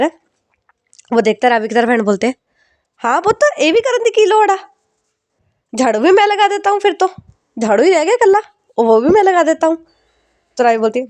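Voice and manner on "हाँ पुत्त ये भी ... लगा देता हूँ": much higher mock-indignant father; hammed-up sarcasm at his daughter; sarcastic father. Father mocks daughter who assigns him all chores, high sarcastic.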